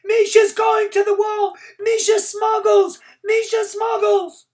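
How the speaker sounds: put-on high, loud, slow child's scream; girl betraying Misha by yelling his crime. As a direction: scream it so the guards hear. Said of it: "She started yelling".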